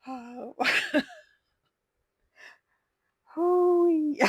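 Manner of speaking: high, loud whoop of laughter; helpless real laughter; hooting with laughter; laughing hard. Real, not acted. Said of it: "that hit my funny bone real hard".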